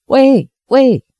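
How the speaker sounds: a narrator's light call for attention; mild shout. A narrator's reading. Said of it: "陶石仙大叫".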